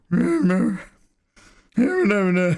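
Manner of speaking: high, rising mock protest; old wizard protesting. Streamer voicing the grand wizard protesting, high. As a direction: wail the noes like a panicking old man. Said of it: "and he's like".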